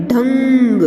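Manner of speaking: ढंग with a long held "ng"; slow ringing nasal in ढंग; nasal anusvar demo. Shown as a demonstration. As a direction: stretch ढंग through the nose. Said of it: "और इसको कैसे उच्चारण करेंगे हम".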